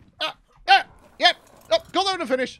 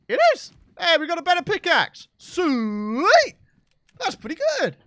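much higher, louder cheer; delighted; 7 semitones higher, 6 dB louder than usual. Real, not acted. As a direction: celebrate the loot. Better pickaxe looted.

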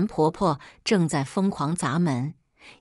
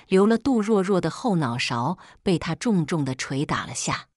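ordinary delivery

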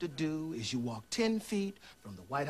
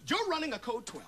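startled realization; 9 semitones higher than usual. Acted. Agent realizing his partner's scheme, startled. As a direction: pitch jumps up on YOU'RE in shock.

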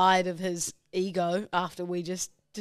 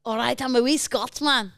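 higher, imitated Scottish accent; 4 semitones higher than usual. Co-host retries host's line, Scottish accent. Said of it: "but in the accent".

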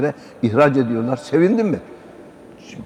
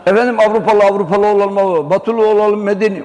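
higher, louder mock pleading; mocking Westernizer; 6 semitones higher, 4 dB louder than usual. Preacher mocks those wanting to be European, higher and louder. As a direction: parody the eager would-be European.

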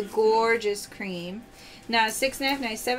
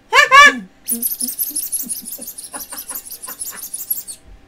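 loud happy laughter; host laughing at the duck; delighted laugh; 2 semitones higher, 9 dB louder than usual. Real, not acted. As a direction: burst out laughing.

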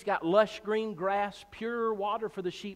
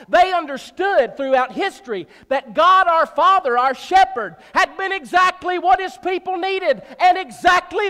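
loud, rising pulpit cry; fiery revival preaching; preaching at full force; 5 semitones higher, 10 dB louder than usual. Real, not acted. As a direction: preach it out to the back row.

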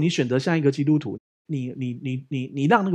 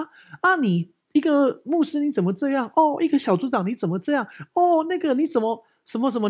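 higher, whiny mock reproach; mock complaining church member; 9 semitones higher than usual. Mimicking offended believers scolding the pastor, mock whiny. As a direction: parrot their accusing tone, lightly hammed up. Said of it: "人家会觉得".

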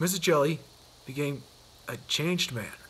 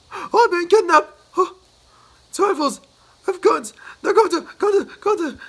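panic; 14 semitones higher, 10 dB louder than usual. Acted. Mister Jelly convinced he is kidnapped, shrill and loud. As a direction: babble about rifles in sheer fright.

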